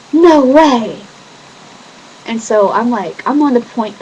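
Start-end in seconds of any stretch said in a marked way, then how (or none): 0.1-1.1 loud, high "No way!"; surprised. Retold or imitated. Seeing her brother's stuff in the car, loudly surprised. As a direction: gasp out in disbelief.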